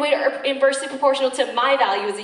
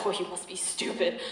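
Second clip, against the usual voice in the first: lower, softer echo of the put-down; chastened self-reproach; 5 semitones lower, 7 dB quieter than usual. Acted. Rejected suitor talking to himself, chastened and soft. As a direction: say it small, to yourself. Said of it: "he's looking at himself like".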